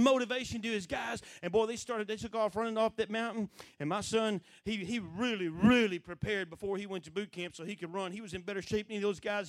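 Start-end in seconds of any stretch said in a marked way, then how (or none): none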